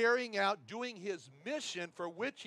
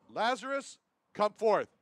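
higher and louder call, "come forth" ringing out; commanding cry; 4 semitones higher, 6 dB louder than usual. Pretend. Preacher voices Jesus calling Lazarus, loud and commanding. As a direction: call a dead man out of the tomb. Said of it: "same commanding voice".

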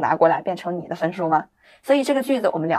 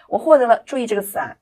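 ordinary talk

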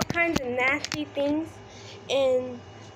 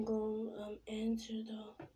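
whispering; 4 semitones lower, 12 dB quieter than usual. Real, not acted. Whispering during call.

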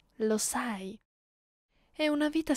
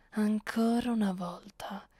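weary whispered scolding; a tired whispered reproach. Acted. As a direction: breathe it out under your breath. Mistress scolding her prisoner.

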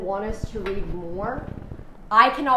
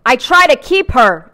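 loud, higher, drawn-out declaration; all caps; 4 semitones higher, 11 dB louder, slower than usual. A narrator's reading. Reader voicing the book's capital letters, loud. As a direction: belt it out as if printed in capitals. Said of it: "in all caps".

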